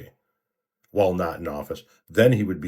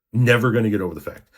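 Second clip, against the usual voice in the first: louder, fast, hammering the point home; angry emphatic repeat; 4 semitones higher, 7 dB louder, faster than usual. Real, not acted. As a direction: repeat it harder and faster, punching "Never".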